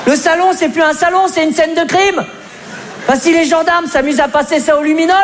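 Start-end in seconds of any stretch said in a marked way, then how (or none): none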